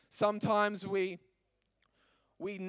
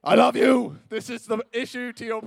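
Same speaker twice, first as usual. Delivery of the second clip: higher and louder, imagined booming answer from heaven; mock voice of God; 5 semitones higher, 12 dB louder than usual. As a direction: play the voice you hope God answers with. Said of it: "expecting this audible voice".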